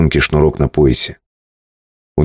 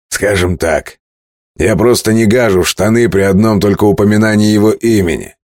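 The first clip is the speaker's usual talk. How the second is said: cocky captain; 6 semitones higher than usual. Acted. Captain mocking Kane.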